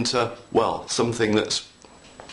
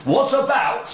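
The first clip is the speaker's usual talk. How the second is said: excited; 13 semitones higher, 4 dB louder, slower than usual. Real, not acted. Revealing special trick.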